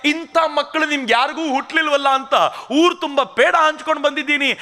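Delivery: a proud mother shaming her son's tears; scornful scolding mother. Retold or imitated. Mother scolds her weeping son.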